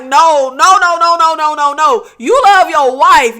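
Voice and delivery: loud string of no's; panicked refusal. Retold or imitated. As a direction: push him away with frantic no's. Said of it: "She's just like".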